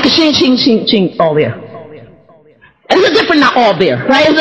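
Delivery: normal talking voice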